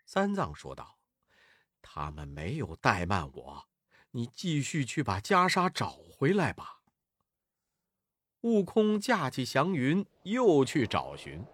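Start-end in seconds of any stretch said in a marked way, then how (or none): 1.8-6.8 lightly voiced calm master, starting low and soft; a gently restrained instruction; mild-mannered monk. A narrator's reading. Sanzang sends his disciple back for the robe, mild.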